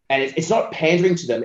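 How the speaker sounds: ordinary talk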